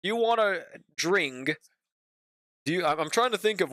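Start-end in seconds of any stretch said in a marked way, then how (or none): 0.0-1.6 slow "drink" with a heavy k on the end; mock British pronunciation. Shown as a demonstration. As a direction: mimic Brits leaning on the final k of "drink". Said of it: "hate how Brits keep saying things".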